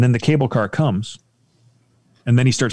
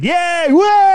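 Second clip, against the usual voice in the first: very high, loud mock whoop; high cheer; 7 dB louder than usual. Riders on top, high mock whoop. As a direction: squeal like thrilled riders.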